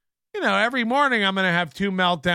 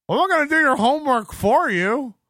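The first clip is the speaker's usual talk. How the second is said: higher-pitched, hammed-up indignant outburst; mock anger; 6 semitones higher, 4 dB louder than usual. Imitating Corey snapping at a guest, mock angry. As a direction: imitate a worked-up host refusing. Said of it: "It's authentic anger".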